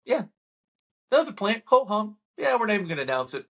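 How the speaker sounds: high, breezy "ho hum" brush-off; mock bored dismissal. Mocking officials who shrug off a new plant, breezy. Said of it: "mocking officials".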